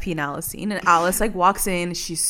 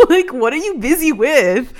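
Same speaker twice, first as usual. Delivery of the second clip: laughing; 6 semitones higher, 4 dB louder than usual. Real, not acted.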